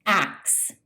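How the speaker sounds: the wrong "ax" for ask; mispronounced ask. Shown as a demonstration. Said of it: "mispronounced by saying".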